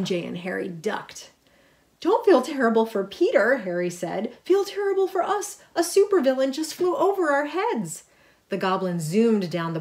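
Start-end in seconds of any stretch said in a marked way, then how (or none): 2.0-3.6 put-on higher, louder panicky boy; half-joking panic at the danger; panicky Harry. A narrator's reading. Harry reacting to MJ, a louder panicky voice. 4.4-8.0 much higher, louder panicky squeal; panicky Harry. A narrator's reading. Harry after the Goblin flies overhead, squealing in panic.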